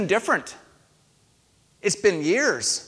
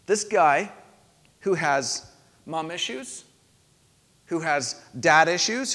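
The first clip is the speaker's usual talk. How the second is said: normal talking voice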